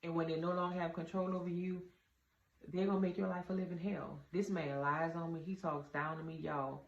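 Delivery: normal talking voice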